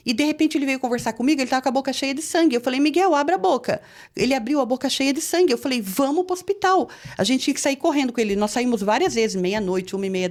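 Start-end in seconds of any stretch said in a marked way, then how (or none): 2.8-3.8 higher-pitched urgent order; a mother's alarm, retold; alarmed retold command. Mãe alarmada com o filho. 5.8-6.9 high-pitched, urgent call; rally everyone in alarm; urgent retold command. Decisão urgente ao ver sangue.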